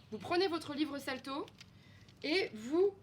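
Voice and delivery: usual speaking voice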